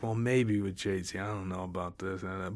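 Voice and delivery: soft, low-key imitation of hesitating; hesitant. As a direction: mimic someone hedging, trailing down softly. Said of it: "he was hesitant with [name] too".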